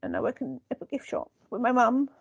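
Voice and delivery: high, hesitant put-on London voice; meek character impression. Co-host as gift-shop worker living in London, high and meek. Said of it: "yeah, I talk like this".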